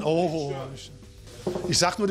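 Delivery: normal talking voice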